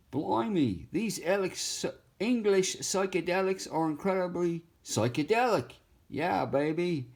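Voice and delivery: put-on high, goofy British accent; Austin Powers. Drawn face talking like a goofy British spy, high British accent. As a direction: camp British spy accent, slowing on yeah baby. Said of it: "Austin Powers would say".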